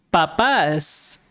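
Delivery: "papas" stressed on the last syllable, pa-PAS; stress on second A. Shown as a demonstration. Teacher showing learners the accented word for parents, "papas" stressed pa-PAS. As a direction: lean on the final A of "papas". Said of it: "add an accent on ... the second A".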